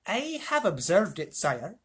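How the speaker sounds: slow, high, stiffly proud mouse; Reepicheep. A narrator's reading. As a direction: answer with dignity as a small mouse. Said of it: "said Reepicheep".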